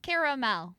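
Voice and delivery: Caramel as CARE-oh-mel; three-syllable caramel. Shown as a demonstration. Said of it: "How do you pronounce caramel".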